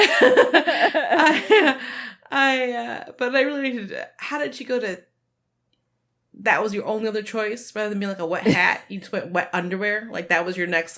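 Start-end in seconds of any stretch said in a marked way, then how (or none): 0.0-5.0 giggling through the words; breaking up with laughter; laughing. Real, not acted.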